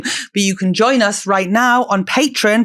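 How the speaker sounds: loud, upbeat promo voice; podcast ad read. Acted. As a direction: sell the Patreon with energy.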